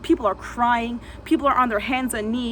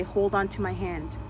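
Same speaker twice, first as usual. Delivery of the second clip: softer, lower calming voice; soothing calm; 4 semitones lower, 6 dB quieter than usual. Retold or imitated. She calms the terrified man beside her, soft and soothing. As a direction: breathe with him gently. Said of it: "I say".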